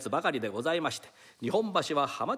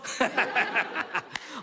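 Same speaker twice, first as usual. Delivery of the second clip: delighted laugh; 7 semitones higher than usual. Acted. Laughter after ここにいます.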